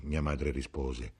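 usual speaking voice

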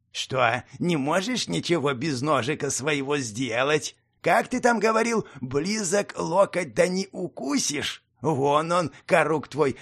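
slow, sneering goblin taunt; nasty nasal goblin sneer. Acted. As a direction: taunt him like a spiteful goblin. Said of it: "ехидно поинтересовался".

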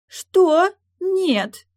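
put-on very high, loud girlish cries; spoiled noblewoman's loud objection; outraged protest. A narrator's reading. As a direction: refuse in shrieking disbelief. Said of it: "воспротивилась маркиза".

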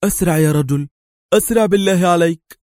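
high, loud urgent plea; pressing, frantic hurry-up call; imitating يائيل. A narrator's reading. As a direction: urge someone on in another man's voice. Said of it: "وبصوت يستحيل تمييزه عن صوت يائيل".

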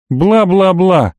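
singsong dismissal, dragged out; mocking interruption. A narrator's reading. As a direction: cut him off with dismissive mockery. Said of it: "перебил я разошедшегося ушастого".

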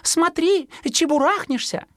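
very high anxious warning; high chirpy caution; warning mother bird. Acted. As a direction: scold high like a worried sparrow mum. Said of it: "беспокоилась мать".

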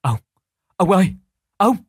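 wife calls her husband loudly and urgently; sharp loud call across the yard; calling out sharply. A narrator's reading. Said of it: "Bà bước ra gọi giật giọng".

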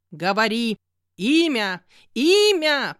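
building to a roaring shout; near roar. A narrator's reading. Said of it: "почти взревела я". (почти рёв, нарастая)

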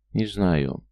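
plain reading voice

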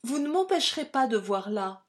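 angry vivacity. A narrator's reading. Louise, angry at his refusal, speaking with lively heat. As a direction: flare up, lively and offended. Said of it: "La jeune fille se fâcha".

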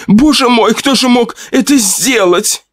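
wife repeating her cry of horror; panicked cry. Acted. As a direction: blurt it in panic. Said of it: "кричала Павлова".

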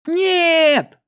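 high panicked shout. A narrator's reading. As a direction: cry out in terror. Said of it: "закричал один из мальчиков".

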